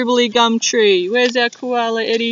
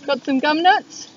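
usual speaking voice

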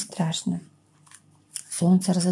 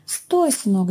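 ordinary delivery, nothing marked

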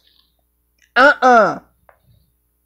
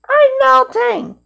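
melting witch; slower than usual. Acted. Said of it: "that's not even a scream word".

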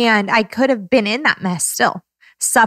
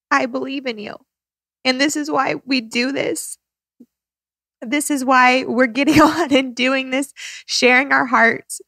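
tearful; 4 semitones higher than usual. Real, not acted. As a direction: let the tears sit just under the words. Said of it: "I was gonna cry too".

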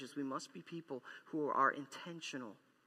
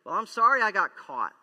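loud, higher, grudging tone; sorry only for getting caught; grudging apology; 4 semitones higher, 12 dB louder than usual. Retold or imitated. Preacher mocking a half-hearted husband, loud and grudging. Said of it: "Kinda like this".

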